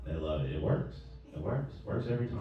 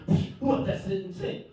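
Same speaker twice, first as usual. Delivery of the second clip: put-on high, scoffing accented jab; other brother ganging up; 12 semitones higher than usual. Other brother scoffing at him about the sink, high and accented. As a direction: pile on with a scoff.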